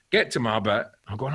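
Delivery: normal talking voice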